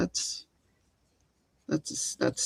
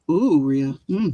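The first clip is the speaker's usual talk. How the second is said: ordinary talk, nothing marked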